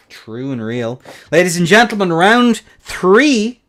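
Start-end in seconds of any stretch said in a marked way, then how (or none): 1.3-3.6 high, loud round announcement; ring announcer. Real, not acted.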